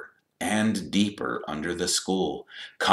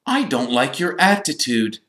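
outraged; 8 semitones higher, 4 dB louder than usual. A narrator's reading. Outraged Percy scolds Malfoy.

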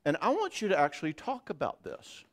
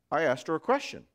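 ordinary talk, nothing marked

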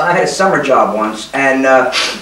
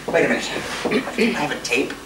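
ordinary delivery

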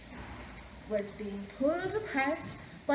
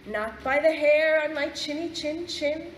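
loud, defiant pig refusal; shouted rudely; 4 semitones higher, 7 dB louder than usual. A narrator's reading. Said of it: "shouted the pig rudely".

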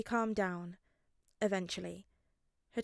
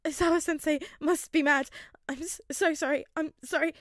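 high, loud tearful sorry; crying apology; 8 semitones higher, 8 dB louder than usual. A narrator's reading. Boy crying to his classmate about his teacher. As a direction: sob out the apologies.

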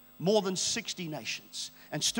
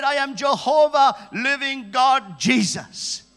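loud, raised, stretched-out declaration; divine proclamation; 7 semitones higher, 10 dB louder, slower than usual. Retold or imitated. God naming himself to John Babu, loud proclamation. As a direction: proclaim each title grandly, easing on the last. God announces his name.

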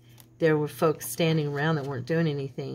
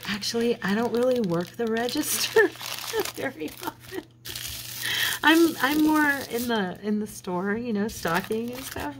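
higher, slightly giggly imitation of the young clerk; sheepish cashier confession; 6 semitones higher than usual. Clerk admitting she rarely works the register, high and giggly. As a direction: let a nervous little laugh slip in mid-line. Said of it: "and then she goes".

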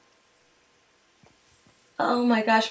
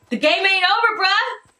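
high, rising like a question; an exclamation with questioning uptalk; voice going up; 5 semitones higher than usual. Real, not acted. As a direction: let the pitch climb to the end. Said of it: "I know my voice went up".

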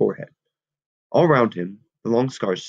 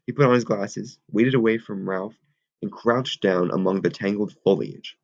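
ordinary delivery

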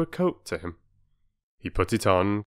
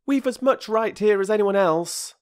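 high, louder schoolboy protest; boy standing his ground; defiant boy; 13 semitones higher, 5 dB louder than usual. A narrator's reading. Peter defying the bargee from the bank, high and loud. Boy's voice.

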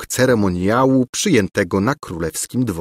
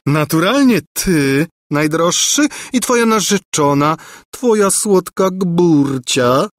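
beaming exclamation; 9 semitones higher, 3 dB louder than usual. A narrator's reading. Governess naming herself his fiancée, radiant. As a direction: exclaim it radiantly, lingering on the pet name. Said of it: "wykrzyknęła rozpromieniona Gburja Furia".

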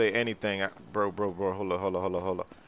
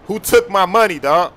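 high, loud angry demand; angry yell; 6 semitones higher, 7 dB louder than usual. Acted. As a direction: shout the question in fury. Demanding who stole money.

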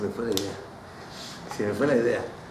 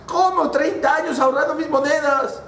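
much higher, outraged disbelief; indignant miser; 11 semitones higher than usual. Acted. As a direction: cry out at the injustice.

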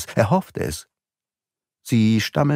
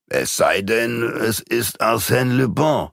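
Massiban's voice; slower than usual. A narrator's reading. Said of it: "meinte Massiban".

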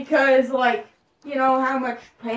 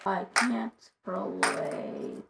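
slow, low, softer intoning; drawn-out catchphrase; 5 semitones lower, 7 dB quieter, much slower than usual. Pretend. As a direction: stretch each word out lazily. Child intones a catchphrase slowly.

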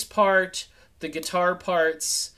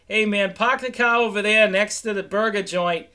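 put-on broad New England accent, a bit louder and higher; mock Boston accent; 4 semitones higher, 6 dB louder than usual. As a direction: play up a Boston guy giving directions. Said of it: "if I start talking like that".

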